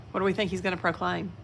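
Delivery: fast, soft teasing question. Real, not acted. Reader asking children to guess, soft and fast. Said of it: "asking listeners".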